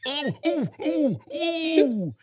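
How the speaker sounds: high, hammed-up ogling oohs; mock leering boys. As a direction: parody schoolboys gawking. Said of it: "all the guys from the boys' school are like".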